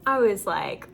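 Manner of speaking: ordinary delivery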